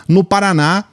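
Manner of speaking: ordinary delivery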